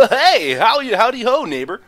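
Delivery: high, loud folksy neighbor; cheery neighbor voice. Retold or imitated. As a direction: hail the neighbor from the yard. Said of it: "we call it, like, Todd".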